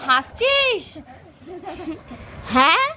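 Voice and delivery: high, loud pretend threat; tease-threaten a giggling toddler; playful threat.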